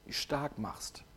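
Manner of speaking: soft whisper. Real, not acted. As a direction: breathe the words out. Predigt über Kinder ermutigen. (leise geflüstert)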